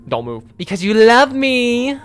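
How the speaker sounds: slow, high, teasing sing-song line; character voice. Acted. Cat girl teasing her companion, slow sly coo. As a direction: tease him sweetly. Voicing game character dialogue.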